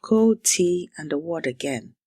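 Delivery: measured, dramatic storytelling voice; story narration. A narrator's reading. Language teacher opening the lesson with a story, narrated dramatically.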